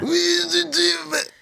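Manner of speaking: put-on very high, slow warped drunk voice; stretched drunk voice.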